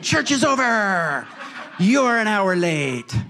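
high, slow call sliding downward; mock calling out. Pastor turning away latecomers, drawn-out mock calling. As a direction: drag out the last word of each phrase. Pastor calls back to late arrivals.

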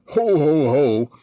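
a lightly voiced deep Santa laugh; hint of a low jolly ho ho ho; mock deep Santa ho ho ho. Shown as a demonstration. Santa coach showing the too-deep voice, lightly mock. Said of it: "you're not down there".

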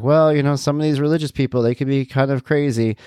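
a hint of a chatty sitcom dad; girlfriend's father. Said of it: "the father is like".

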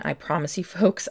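ordinary talk, nothing marked

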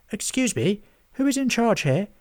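high, polite character voice; a small creature's eager question; Hobgob asking. A narrator's reading. Hobgob politely asks a rushing herald for help.

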